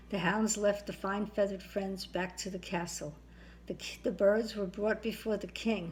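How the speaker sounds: ordinary delivery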